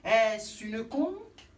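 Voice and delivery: slow, dreamy and lyrical. Acted. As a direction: savour it like poetry.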